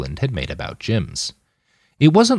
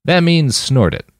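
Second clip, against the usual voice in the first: slow, higher-pitched helpful explanation; 9 semitones higher, 4 dB louder than usual. A narrator's reading. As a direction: spell it out helpfully, slowly. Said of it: "Roland said helpfully".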